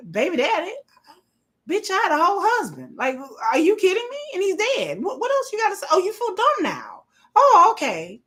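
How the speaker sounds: high-pitched, incredulous telling-off; retold indignant clapback. Widow clapping back at a troll, high indignant retort. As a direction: pile on the mock disbelief.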